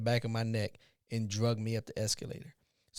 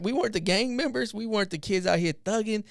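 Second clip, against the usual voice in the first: much higher, fervent insistence; emphatic protest; 7 semitones higher than usual. Real, not acted. Insisting they were good kids.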